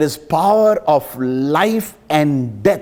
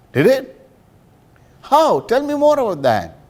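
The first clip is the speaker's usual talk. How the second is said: curious surprise; 10 semitones higher than usual. Retold or imitated. Chaplain asks footballer eagerly.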